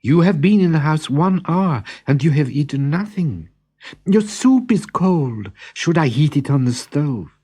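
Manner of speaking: high, gentle worried voice; wife worrying about her husband; concerned wife. Acted. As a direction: fuss over him kindly.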